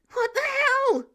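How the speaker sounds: high, loud startled yell; Clint's shriek at a kid on the ceiling, loud and high; startled shout. Acted. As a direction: yelp it in shock. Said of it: "Clint said".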